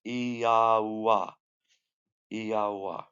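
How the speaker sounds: "Iyawa" said as vowels only, ee-yah-wah; "Iyawa" with no consonants. Shown as a demonstration. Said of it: "the vowels that would come out".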